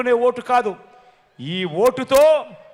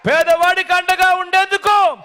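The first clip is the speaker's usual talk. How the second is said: high, sustained calling to a crowd; rallying shout; 3 semitones higher than usual. Real, not acted. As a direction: urge the whole crowd to join in. Urges crowd to respond loudly.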